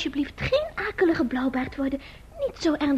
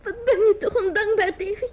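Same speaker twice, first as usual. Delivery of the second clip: tearful; 7 semitones higher than usual. Acted. Ruzie, vrouw in tranen.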